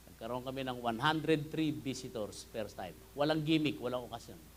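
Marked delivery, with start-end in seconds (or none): none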